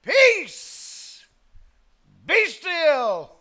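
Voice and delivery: high, loud commanding cry; a ringing, forceful decree to the sea; mighty command. Acted. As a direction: command the storm with full force. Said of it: "commanded that which he had created".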